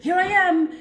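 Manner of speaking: loud, high greeting at the door; calling out. Retold or imitated. Granddaughter arriving at grandmother's door, calling out loudly. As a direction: announce yourself brightly.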